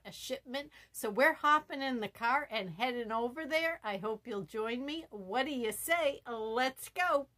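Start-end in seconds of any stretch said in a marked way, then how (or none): none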